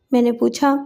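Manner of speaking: plain reading voice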